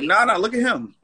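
quick, high deflection; deflecting blame. Acted. Voicing someone caught deflecting blame.

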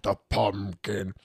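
imitating a kid's spooky cartoon impression; whispery start, then slow and drawn out; Jack the Pumpkin. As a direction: mimic a son doing a movie voice. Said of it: "like, uh, Nightmare Before Christmas".

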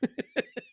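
soft laugh at own joke; amused chuckle. Real, not acted. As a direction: chuckle quietly after the punchline. Laughs after own joke yell.

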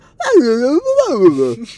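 loud, high swooping gibberish; wordless mock accent, curling up at the end; high wordless British-lilt babble. Shown as a demonstration. Showing the accent formula, loud high swooping babble. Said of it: "take it up a couple levels".

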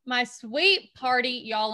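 ordinary talk, nothing marked

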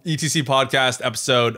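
hammed-up podcast plug; promo announcer. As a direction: sell the next episode like a radio promo. Repeats the episode announcement.

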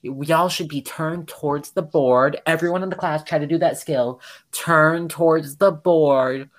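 slow, drawn-out sarcastic teacher; mock a condescending teacher, stretching out the command; heavy sarcasm, imitating a teacher lecturing the class. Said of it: "he says it in the most sarcastic tone".

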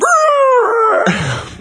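high trilled revving noise; imitated engine roar. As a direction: roar like a car losing control. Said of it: "he's just gone".

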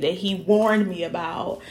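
normal talking voice

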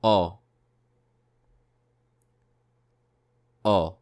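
O as a pure rounded vowel; model vowel sound. Shown as a demonstration. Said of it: "let's move on to the second sound".

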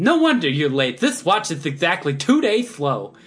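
a hint of the fussy Mad Hatter scolding the rabbit; Mad Hatter impression. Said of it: "I can do a pretty good Mad Hatter impression".

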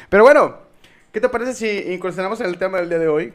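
loud, fast, lively; upbeat host segue. Real, not acted. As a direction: pump it up to introduce the topic. Host's upbeat segue to topic.

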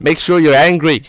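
high, loud Aussie urging; exaggerated Australian egging-on; mock Australian shout. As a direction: bark it as a hammed-up Australian.

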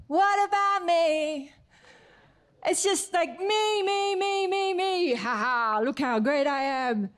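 high, slow, whiny self-centred mimicry; sing-song me me me; mock proud voice. Preacher mimics the proud heart, whiny and high.

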